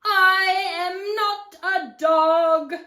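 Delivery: slow, loud, howling singsong; offended coyote. Acted. Coyote correcting the cactus, offended and drawn out. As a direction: draw it out high like a coyote.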